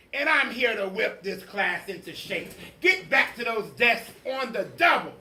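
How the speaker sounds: a drill sergeant teacher commanding; stern witch teacher. Acted. Strict teacher giving orders.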